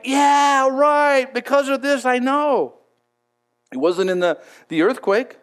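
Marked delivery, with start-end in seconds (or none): 0.0-2.7 high, loud drawn-out cheer; a hammed-up rush of certainty, pitched high; mock elation. Preacher acts Elijah supposedly thrilled by displays of power, mock elation. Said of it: "he wasn't like".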